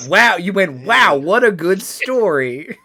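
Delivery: loud, high, gushing wow; exaggerated, slow singsong admiration; mock amazement. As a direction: draw out the praise, hammed-up. Game master voices forced admiration of story.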